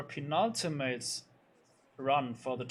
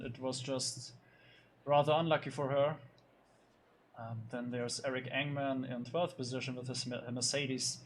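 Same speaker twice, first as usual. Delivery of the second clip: ordinary talk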